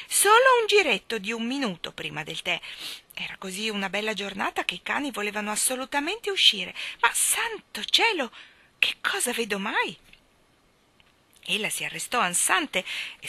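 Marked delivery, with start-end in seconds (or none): 0.1-2.6 raised, higher call; mild shrieking. A narrator's reading. Lady calls after her running dogs, mildly shrieking. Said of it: "trafelata e strillava". 7.0-10.0 high, drawn-out astonishment; shocked exclamation. A narrator's reading. Lady catches sight of the boy, shocked.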